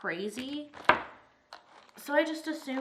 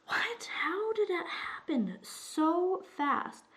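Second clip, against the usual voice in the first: high, breathy, drawn-out disbelief; whispery, incredulous "what?"; shocked; 8 semitones higher, slower than usual. Retold or imitated. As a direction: re-enact stunned disbelief, slowly and higher. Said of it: "So I was just shocked".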